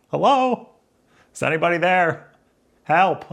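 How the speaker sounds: high, playful call into the void; mock plea for help. Pretend calling out for help.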